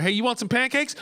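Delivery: put-on asking the toddler about pancakes; dad asking plainly. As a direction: clear pancakes question. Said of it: "problems with her C's and her T's".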